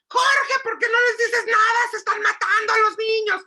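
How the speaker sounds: high, loud scolding of a husband; acted-out fed-up wife shouting; exasperated yelling. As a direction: yell at your husband for doing nothing. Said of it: "por eso terminamos gritando".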